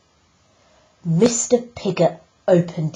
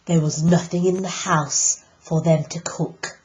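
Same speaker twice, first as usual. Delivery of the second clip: ordinary delivery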